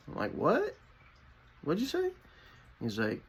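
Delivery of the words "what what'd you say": a high-pitched, shocked double take; shocked what. Retold or imitated. Telling friend he heard right, stunned high-pitched disbelief. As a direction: jump way up in pitch, caught off guard.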